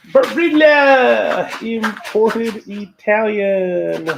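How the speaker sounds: loud, slow, high showy product announcement; grand announcement. Acted. Showing the pasta box to viewers as a proud slow ad pitch.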